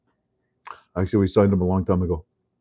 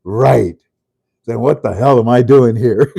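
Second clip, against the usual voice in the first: louder mock exasperation; indignant retort; 7 dB louder than usual. Quoting the official, louder and fed up. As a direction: toss it back, fed up and a bit louder. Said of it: "He said".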